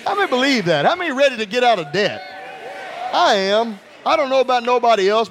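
ordinary talk, nothing marked

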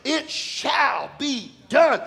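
ordinary delivery

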